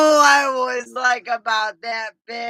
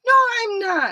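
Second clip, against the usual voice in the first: indignant protest; 12 semitones higher, 5 dB louder, faster than usual. Retold or imitated. Retold protest to family teasing.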